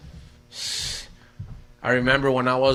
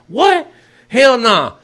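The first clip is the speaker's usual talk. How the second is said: much higher, louder mock outrage; shocked refusal; 7 semitones higher, 8 dB louder, much slower than usual. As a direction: retell the shock, jump up high. Shocked reply to uncle.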